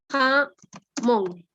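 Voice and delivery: "jamón" slowly, as ja... món; split into syllables. Shown as a demonstration. Teacher showing students the syllables, "jamón" split as ja-món. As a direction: break "jamón" after ja, with a clear pause. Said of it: "una sílaba libre que es ja".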